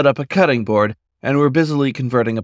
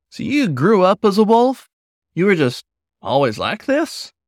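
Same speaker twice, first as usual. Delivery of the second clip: put-on lightly higher, eager young wolf voice; young Paul, curious; 9 semitones higher than usual. Paul asks trucker, young wolf.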